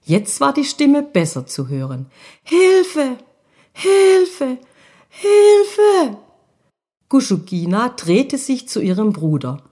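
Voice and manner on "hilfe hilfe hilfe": put-on high child's cry growing louder each time; a far-off child calling for help, rising in panic; high child's voice crying Hilfe, building from a call to a louder cry. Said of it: "eine Kinderstimme".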